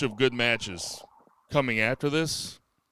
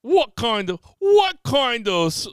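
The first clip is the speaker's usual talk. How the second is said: loud, high mock Australian question; hammed-up Aussie rising question; 11 semitones higher, 9 dB louder than usual. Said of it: "That's Australian".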